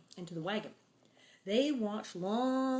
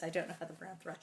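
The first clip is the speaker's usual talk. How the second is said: ordinary delivery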